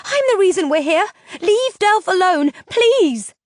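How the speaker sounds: high, loud, frantic begging; panicked plea; desperate pleading. A narrator's reading. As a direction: beg her to stop, near screaming. Pleading after screaming at captor.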